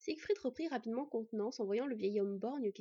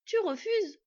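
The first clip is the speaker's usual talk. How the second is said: indignant; 10 semitones higher, 9 dB louder, slower than usual. Acted. Boy annoyed at refusal.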